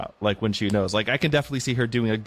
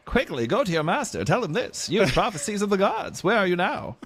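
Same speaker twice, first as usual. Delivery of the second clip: put-on a half-managed higher transatlantic accent; Katharine Hepburn transatlantic accent; 7 semitones higher than usual. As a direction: try for a clipped old Hollywood transatlantic accent and only half pull it off. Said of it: "like, transatlantic accent".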